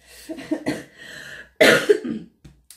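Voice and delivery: loud real cough; a rough cough after being ill; coughing fit. Real, not acted. Sick after the holidays, real loud coughing. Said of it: "Excusez-moi".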